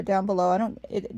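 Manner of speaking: ordinary talk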